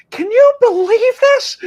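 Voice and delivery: hammed-up baffled question; incredulous. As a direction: pitch way up in mock astonishment. Acting out own baffled reaction.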